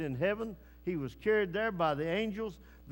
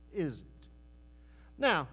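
ordinary talk, nothing marked

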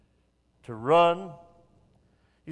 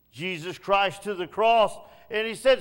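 ordinary talk, nothing marked

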